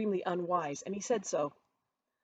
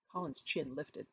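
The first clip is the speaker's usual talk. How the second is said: ordinary delivery, nothing marked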